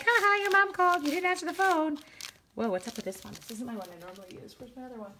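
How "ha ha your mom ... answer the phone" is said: teasing friend. Retold or imitated. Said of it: "She's like".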